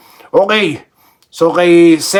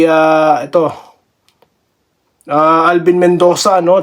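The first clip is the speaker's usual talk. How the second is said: ordinary talk, nothing marked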